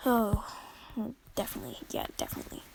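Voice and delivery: breathy sarcastic whisper under the breath; whispered ironic agreement. Real, not acted. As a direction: mutter it drily, not meant to be heard. Sarcastic aside after quote.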